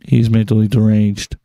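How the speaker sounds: low, loud, hammered statement; emphatic loud verdict. Real, not acted. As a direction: land it hard and loud. Emphatic conclusion.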